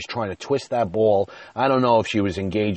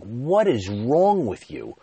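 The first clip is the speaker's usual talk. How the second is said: slow, higher exasperated outburst; mild mock scream; 4 semitones higher, 4 dB louder, slower than usual. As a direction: draw out the exasperation, re-enacting a row. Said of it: "I started screaming".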